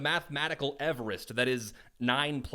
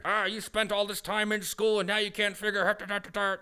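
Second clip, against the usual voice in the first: mock berating parent; 7 semitones higher, 4 dB louder than usual.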